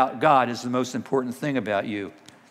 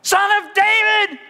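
full-force high-pitched yell; crying out louder; 6 dB louder than usual. Acted. Preacher as hushed blind men calling louder, all-out scream. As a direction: scream the name to be heard over everyone. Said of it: "They cried out all the louder".

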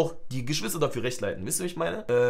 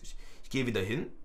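ordinary delivery

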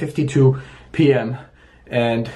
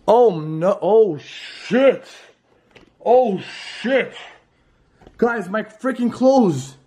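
high frightened shrieks; panic, voice jumping high; shrieking panic; 7 semitones higher, slower than usual. Real, not acted. Finds clothes, bed made.

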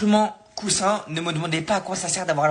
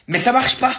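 higher and louder, "pas" hit hard; exasperated complaint; 8 semitones higher, 5 dB louder than usual. Real, not acted. Youtuber fed up with the TikTok trick, exasperated cry. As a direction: protest that it still does not work.

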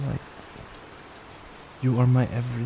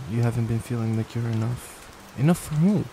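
normal talking voice

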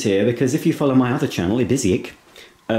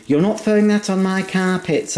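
mum voice; 10 semitones higher than usual. Retold or imitated. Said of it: "My mum says".

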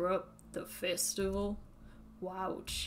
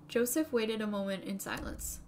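usual speaking voice

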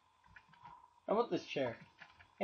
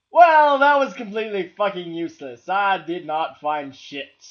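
annoyed rant; 9 dB louder, faster than usual. Real, not acted. Frustration after cheating.

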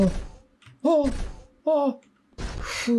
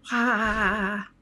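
ordinary talk

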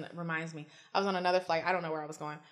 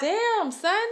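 mock impressed; 9 semitones higher, 3 dB louder than usual. Mock impressed reply to kid.

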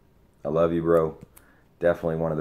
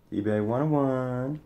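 mock announcer; 9 semitones higher, slower than usual. Jokey aside in put-on voice.

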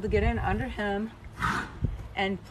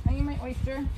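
ordinary talk, nothing marked

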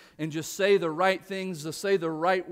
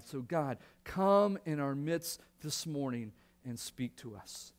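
softer, earnest prayer voice; pastoral prayer; 4 dB quieter than usual. Real, not acted. Pastor praying before the sermon, soft and earnest. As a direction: pray quietly over the congregation.

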